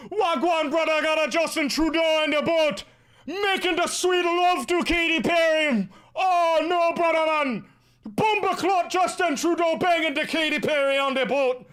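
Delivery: high, loud, hammed-up Jamaican patois; awful Jamaican accent. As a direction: holler it in a bad Jamaican accent. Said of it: "That's an awful accent".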